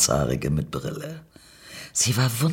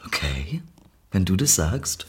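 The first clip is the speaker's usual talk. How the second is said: low, softer cool reply; dark-voiced stranger; 4 semitones lower, 4 dB quieter than usual. Stranger doubts the nervous narrator, low and cool. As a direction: answer low and unimpressed.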